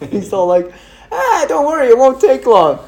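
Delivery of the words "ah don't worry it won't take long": high-pitched, airy dismissal; mock-casual reassurance, pitched way up; imitated breezy brush-off. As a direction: wave the worry away in a much higher voice. Retold breezy brush-off by Mark.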